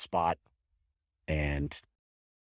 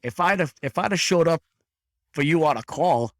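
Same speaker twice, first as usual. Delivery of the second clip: put-on loud, high Boston accent; 9 semitones higher, 6 dB louder than usual. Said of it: "in that Boston accent".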